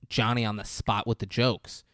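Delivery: ordinary talk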